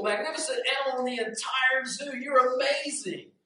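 high, excited praise; star-struck crow, pitched high; gushing admiration. Acted. Crow gushes at the parrot.

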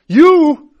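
very high, loud punched word; sudden rebuke. Retold or imitated. Holy Spirit confronting the pastor, sharp loud outburst.

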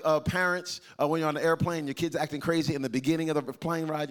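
ordinary delivery

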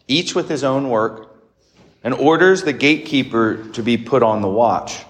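measured liturgical reading voice; formal Gospel reading. Acted. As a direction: proclaim the Gospel solemnly.

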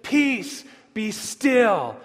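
acted order to wind and waves; commanding the storm. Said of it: "He stands up into the chaos of the storm".

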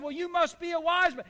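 a mother humoring her son; lightly voiced mother.

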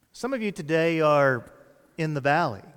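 usual speaking voice

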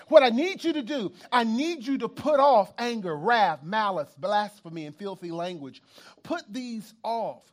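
loud, driving sermon delivery; full-force preaching. Acted. Preacher quoting the apostle to the church at full force. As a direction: preach it out over the crowd.

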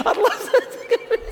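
cracking up at own joke; laughing. Real, not acted. Repeats punchline laughing.

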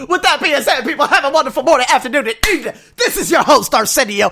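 high, loud laughter through the farewell; laughing sign-off. Real, not acted. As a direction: giggle the outro.

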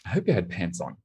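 soft, dropped-volume comic afterthought; imitated motherly aside. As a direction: slip it in quietly as mom's caveat.

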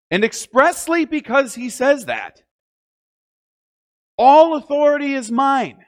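high, loud proclamation; preaching loudly. Real, not acted.

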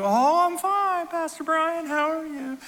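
high, slow Southern lady voice. Retold or imitated. Widow answering her pastor's call, in a high Southern accent. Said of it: "And she's Southern".

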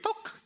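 short clicked "tuk"; imitated mud plop. Dried mud drops on a beard, short imitated tap. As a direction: make the tap of a falling clod.

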